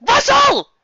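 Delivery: loud high scream of a name; shouted name. Acted. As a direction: yell it across the room, high. Screaming name in fanfic scene.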